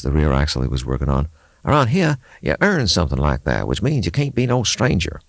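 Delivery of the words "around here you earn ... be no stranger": put-on higher, musing old mechanic voice; a grizzled local thinking aloud; gruff mechanic. Mechanic Levi talking to the axle, musing aloud.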